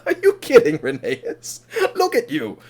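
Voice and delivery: laughing through the words, incredulous; chuckling. Acted. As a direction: play helpless mocking laughter at a friend, voice jumping high. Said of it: "starts chuckling".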